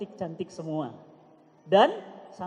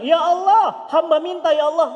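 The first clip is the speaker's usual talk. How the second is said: loud fervent prayer; 8 semitones higher, 9 dB louder than usual. Retold or imitated.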